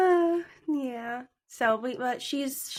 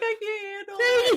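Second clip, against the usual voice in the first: high, overwrought cry of despair; mock distraught wail; 11 semitones higher, 3 dB louder than usual. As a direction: ham up the can't-cope whine. Mock meltdown over TV wedding.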